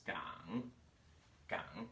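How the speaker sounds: Gang dipped low, then rising back up; dipping third tone. Shown as a demonstration. Teacher showing how a third tone dips, dipping tone. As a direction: drop low on Gang and curl up. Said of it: "as a third tone dips".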